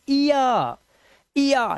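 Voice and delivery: इया high, gliding from ee to aa; ee-aa sound. Shown as a demonstration. Teacher showing the sound before R, high drawn-out इया. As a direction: draw out इया as ee-yaa. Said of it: "इनकी आवाज दोनों की क्या हो जाएगी".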